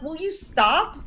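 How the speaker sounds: exasperated scolding. Retold or imitated. Child telling mother to quit trimming hedges, exasperated. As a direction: scold with drawn-out disbelief.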